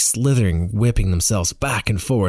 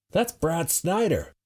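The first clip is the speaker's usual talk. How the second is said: high, slow call, softened as if distant; shouted from crowd; 9 semitones higher, 7 dB quieter, slower than usual. A narrator's reading. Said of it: "someone shouts".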